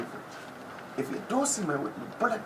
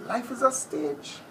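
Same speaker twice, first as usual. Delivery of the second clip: dramatic proclamation; 8 semitones higher than usual. Acted. Dramatic opening pronouncement.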